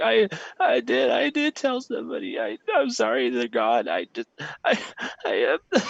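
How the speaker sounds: put-on high, broken sobbing child voice; a frightened boy blurting a confession through tears; boy confessing to the priest, high and tearful; crying boy. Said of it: "he breaks down and he just begins, like, crying".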